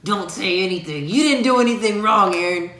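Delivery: acting out a loud, defensive conscience. Partner voicing his own inner defence, loud and insistent. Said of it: "the louder voice in my head".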